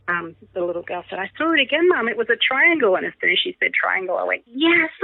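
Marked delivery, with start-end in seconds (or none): 1.2-3.0 put-on excited child. Little daughter spots UFO. 4.5-4.9 high, thrilled cheer; a delighted little yelp of triumph; excited yes. Retold or imitated. Mum cheering when her daughter says triangle, thrilled and high.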